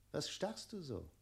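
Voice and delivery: ordinary talk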